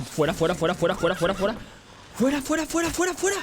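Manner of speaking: loud, rising to very high screams; panicked shouting. Real, not acted. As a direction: shriek to drive it off. Ghoul attacking, shooing it.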